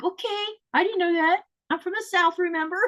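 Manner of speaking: high-pitched playful protest; hammed-up excuse, pitched high; mock-defensive reply. Acting out her sheepish reply to scolding.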